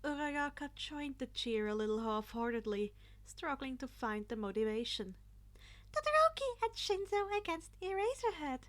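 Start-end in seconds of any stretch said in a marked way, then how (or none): 5.9-8.6 high, loud chirpy announcing voice; cheerful principal. A narrator's reading. Principal announcing the teams to the class, high cheerful call. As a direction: announce it like a bubbly host.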